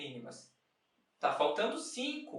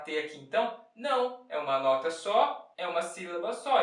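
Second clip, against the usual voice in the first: usual speaking voice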